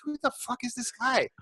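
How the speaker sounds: high, hushed, incredulous aside. Retold or imitated. Guest turned to friends about a stranger, incredulous hushed aside. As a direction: act out a soft, high-pitched aside of disbelief.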